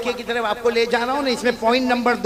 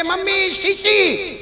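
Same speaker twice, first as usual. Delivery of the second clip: put-on child's call; 11 semitones higher, 5 dB louder, much slower than usual. Child calling mother on arrival.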